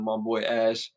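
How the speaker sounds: ordinary talk, nothing marked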